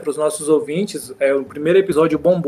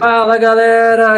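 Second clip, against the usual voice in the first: excited greeting; 7 semitones higher, 6 dB louder than usual. Acted. Host opens the show loudly.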